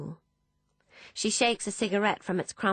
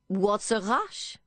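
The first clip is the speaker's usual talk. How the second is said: put-on slow, higher French-accented drawl; heavy French accent; 4 semitones higher, slower than usual. A narrator's reading. Philippe smiling round his cigar, heavy French accent. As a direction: stall them, in a thick French accent. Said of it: "in a heavy French accent".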